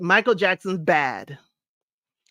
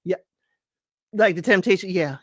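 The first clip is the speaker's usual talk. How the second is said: ordinary talk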